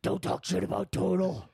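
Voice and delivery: a hammed-up Batman imitation; weird Batman voice. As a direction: do the meme guy's Batman growl. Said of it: "a weird Batman voice".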